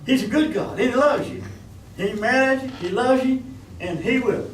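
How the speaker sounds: high, loud preacher's cry; fervent revival shout; preaching at full force. Acted. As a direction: proclaim God's love.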